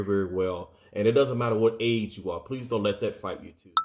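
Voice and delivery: ordinary talk, nothing marked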